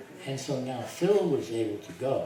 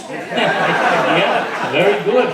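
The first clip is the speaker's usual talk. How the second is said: laughing delight, much higher and louder; 7 semitones higher, 17 dB louder, much slower than usual. Retold or imitated. Answering Harry Kenmore's good news, laughing. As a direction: gush through laughter.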